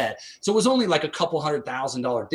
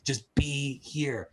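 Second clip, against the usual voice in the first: very slow, softer request; slow soft plea; 5 dB quieter, much slower than usual. Retold or imitated. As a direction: plead it slowly and tenderly. Wife's plea, retold.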